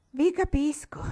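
high, loud sly jab; mocking old crone. A narrator's reading. Old woman feigning to misunderstand herbalist, high and loud. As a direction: twist his words like a sly crone.

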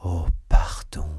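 loud, low, whispery plea; cri de supplication. A narrator's reading. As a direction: beg forgiveness with force. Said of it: "s'écria Felton". (implorer avec force, d'une voix étouffée)

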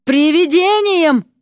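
high, stretched-out yell; drawn-out threatening cry; bawling. A narrator's reading. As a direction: howl the word slowly. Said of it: "орала лягушка".

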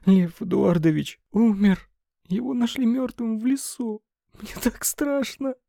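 high, tearful and frightened; sobbing. A narrator's reading. Secretary to her boss, sobbing high in fear. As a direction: break down crying as you tell it. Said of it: "снова разрыдалась Лена".

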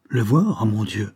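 startled echo, voice rising on voir; a hint of dread on voir, ending up; going pale, aghast. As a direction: repeat le voir in a rising, alarmed gasp. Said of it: "fit-elle en pâlissant".

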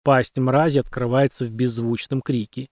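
ordinary delivery, nothing marked

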